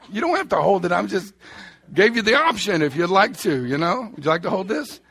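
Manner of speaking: mock-innocent teasing of the boy; playful teasing. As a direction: tease him loudly with a grin. Teasing son holding his foot.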